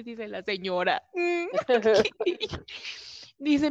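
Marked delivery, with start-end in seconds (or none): 1.1-3.3 high giggle. Retold or imitated. Laughing with the neighbor.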